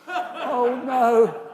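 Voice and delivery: high, loud mock horror; realizing the blunder; inner dismay. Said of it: "I thought".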